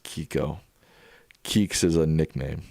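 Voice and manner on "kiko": "Kiko" said slowly as KEE-ko; name pronounced. Shown as a demonstration.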